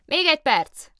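high, loud call back; station master shouts back to the engine driver, high and loud; shouting back. Acted. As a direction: fling it back across the platform. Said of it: "kiáltotta vissza".

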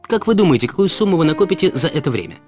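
ordinary talk

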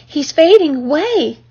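high, slow wail of dismay; a restrained storybook scream; alarmed cry. A narrator's reading. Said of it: "screamed Fern".

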